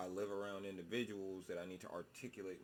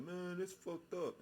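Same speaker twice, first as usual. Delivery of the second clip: high, slow mock whine; mock lament; 10 semitones higher, slower than usual. People complaining in a high, slow mock whine. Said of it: "They be like".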